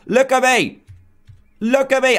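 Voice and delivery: high, sing-song look at me; proudly showing off the hat; delighted showing off. Real, not acted. Gamer showing off his chef hat, delighted.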